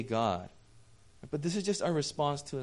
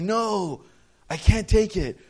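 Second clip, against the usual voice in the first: higher, louder acted protest; flustered refusal; 7 semitones higher, 6 dB louder, slower than usual. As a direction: push the gift away in disbelief. Refusing the costly gift.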